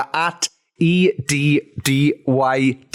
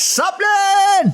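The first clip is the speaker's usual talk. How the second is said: high, drawn-out holler; shouted catchphrase. Pretend. Co-host kicking off the podcast, high drawn-out shout. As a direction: shout it like a show's battle cry.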